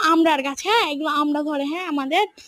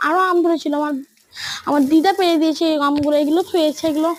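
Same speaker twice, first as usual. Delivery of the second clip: ordinary delivery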